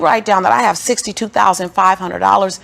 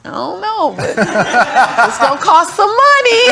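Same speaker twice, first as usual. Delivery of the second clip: higher, laughing through the words; laughing embarrassed reply; 10 semitones higher, 5 dB louder, slower than usual. Retold or imitated. Lisa laughing as she answers the tellers. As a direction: giggle it out, a bit shy.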